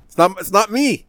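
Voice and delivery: loud, high indignant protest; heated rant. Real, not acted. Heated defensive rant about match.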